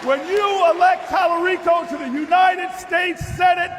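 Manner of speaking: high, loud campaign roar; preaching at full force. Acted. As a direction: shout it to the whole hall. Repeated after applause.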